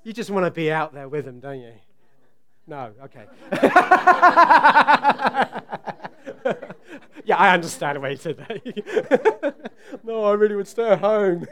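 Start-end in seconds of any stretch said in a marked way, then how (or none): 3.5-11.5 laughing through the words; laughing back at the congregation. Real, not acted. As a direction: chuckle out the reply.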